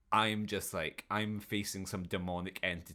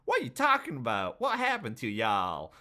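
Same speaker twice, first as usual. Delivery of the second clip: put-on Southern US accent; 3 semitones higher, 7 dB louder than usual. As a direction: holler it in a mock Southern accent. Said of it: "still doing the accent".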